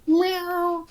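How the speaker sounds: high, soft cat imitation; soft meow. Praising the sketch's mouth effects, soft high meow. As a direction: mew softly, way up high. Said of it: "I love the soft sound effects".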